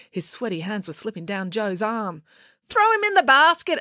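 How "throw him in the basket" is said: high, urgent instruction; high-pitched urgent plea; urging. A narrator's reading. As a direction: push them to act now. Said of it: "urged April".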